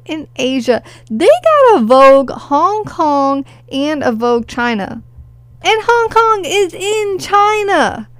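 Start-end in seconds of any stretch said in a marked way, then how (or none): 5.6-8.1 incredulous. Real, not acted. Incredulous high-pitched jab.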